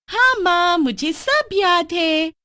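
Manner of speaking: high, loud, slow sing-song reply; sweetly dismissing the warning; young girl Bini. Acted. Daughter reassuring mother, high drawn-out girlish voice.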